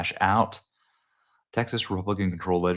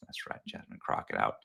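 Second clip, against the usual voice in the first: whisper; 11 semitones lower, 11 dB quieter than usual. Real, not acted. Gloating aside about Crockett.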